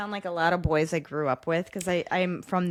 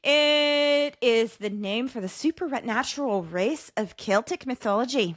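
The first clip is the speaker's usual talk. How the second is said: put-on Irish accent; 4 semitones higher than usual. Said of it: "your accent is so impressive".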